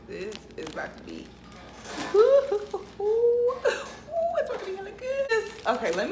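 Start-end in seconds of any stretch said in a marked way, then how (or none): 2.1-5.4 squealing with laughter, high; giggly, delighted squeal; laughing. Real, not acted. Excited over the crab.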